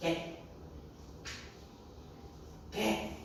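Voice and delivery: put-on hushed, halting child's question; whispering grandchild. Grandchild creeps up to ask grandfather, hushed. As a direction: softly coax an answer out of grandpa. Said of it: "lalu berbisik".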